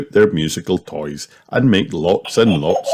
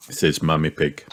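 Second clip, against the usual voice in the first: usual speaking voice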